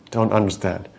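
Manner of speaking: ordinary talk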